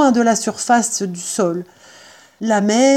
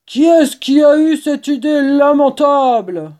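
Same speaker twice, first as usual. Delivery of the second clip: loud, high exasperated complaint; furious farmer; 6 semitones higher, 6 dB louder than usual. Acted. Boggis blames his partners for the plan, furious farmer voice. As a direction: demand who is to blame. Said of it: "très fatigués et furieux".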